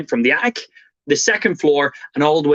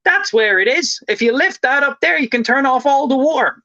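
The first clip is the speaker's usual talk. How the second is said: high, eager mock explaining voice; helpful neighbour; 10 semitones higher than usual. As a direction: imitate a neighbour confidently pointing it out. Wife proudly shows wrong cover.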